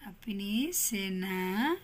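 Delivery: slow, drawn-out dictation; dictating slowly. Shown as a demonstration. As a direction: spell it out word by word for the class. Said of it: "teacher dictating answer slowly". (बच्चों को धीरे-धीरे लिखवाना)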